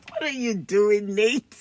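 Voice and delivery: normal talking voice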